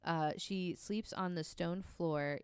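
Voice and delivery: normal talking voice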